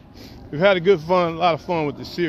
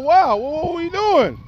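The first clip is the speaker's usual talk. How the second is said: retold disbelief; 10 semitones higher, 4 dB louder than usual. Retold shock at her remark.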